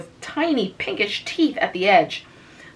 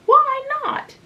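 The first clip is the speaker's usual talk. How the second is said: snapped. A narrator's reading. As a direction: snap it back high. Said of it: "snapped Frances".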